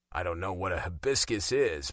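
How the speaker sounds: ordinary delivery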